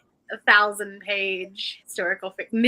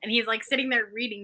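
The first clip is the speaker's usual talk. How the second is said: usual speaking voice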